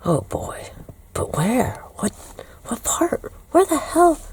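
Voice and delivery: hushed nervous whisper. Retold or imitated. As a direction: whisper so nobody hears. Whispering in hidden bus space.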